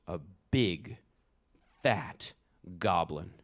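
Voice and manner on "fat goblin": plain reading voice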